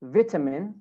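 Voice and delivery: British "vitamin" with short "vit" first syllable; British accent. Shown as a demonstration. Teacher models British "vitamin" for students, short first vowel. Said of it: "In British accent".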